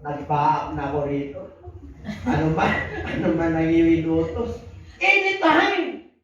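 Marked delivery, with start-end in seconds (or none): 5.0-6.1 mock eager reply. Mocking the dutiful son.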